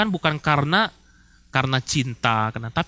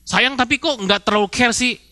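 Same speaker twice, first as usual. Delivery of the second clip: ordinary talk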